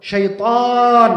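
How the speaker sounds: শয়তান very slow with the long a drawn out; drawn-out শয়তান, long vowel held; stretched alif. Shown as a demonstration. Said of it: "হুরুফুল ইশবাহ এই জন্য নিয়ে আসলেন".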